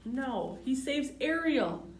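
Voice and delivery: ordinary talk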